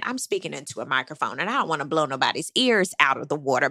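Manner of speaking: normal talking voice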